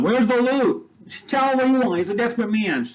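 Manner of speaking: a hammed-up woman pressing a captive with a gun on her; pushy accomplice. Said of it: "And she's like".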